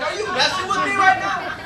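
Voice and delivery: high-pitched incredulous protest; squeaky exasperated outburst. Acted. Exasperated vendor to customer.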